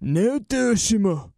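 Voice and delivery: name said in a put-on Japanese accent. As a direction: slip into an imitated Japanese accent. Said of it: "with a Japanese accent".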